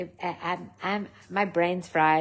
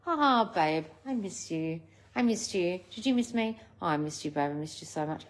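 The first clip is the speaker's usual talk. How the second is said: soft, sappy pining in a much quieter voice; mock lovesick; 2 semitones lower, 7 dB quieter than usual. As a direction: parody someone moping over a lover. Mocking the yearning state.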